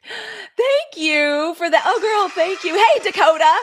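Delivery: gasp, then loud drawn-out thanks; light up over the gift; gasping delight. Real, not acted. Thanking a viewer for a gift, gasping delighted.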